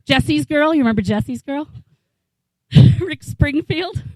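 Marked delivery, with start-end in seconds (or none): none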